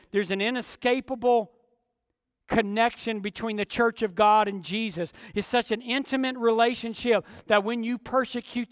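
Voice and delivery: loud, high, ringing sermon climb; fiery pulpit preaching; preaching at full force. Acted. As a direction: preach it hard, voice rising.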